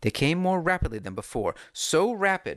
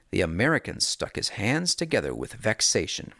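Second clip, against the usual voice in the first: ordinary delivery, nothing marked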